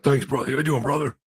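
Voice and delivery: mock the wrestler's catchphrase; imitating Hulk Hogan. Hulk Hogan greeting the host, imitated wrestler voice. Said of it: "He's like".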